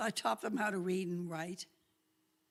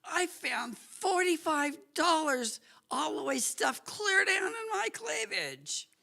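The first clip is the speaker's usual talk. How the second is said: rising higher and louder with glee; gleeful higher friend voice; excited friend voice; 8 semitones higher, 4 dB louder than usual. Retold or imitated. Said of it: "She goes".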